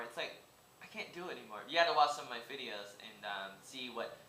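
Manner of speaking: ordinary talk, nothing marked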